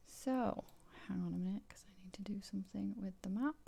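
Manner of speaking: fast whispered aside; whispering. Real, not acted. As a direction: whisper it quickly to yourself. Game master aside over the map.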